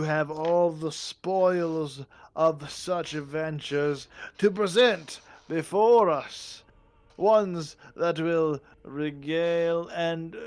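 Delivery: loud, slow, grandiose monarch; pompous goblin king. Acted. Goblin king expects spoils from the adventurers, pompous and grand. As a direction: savour every word like a greedy king.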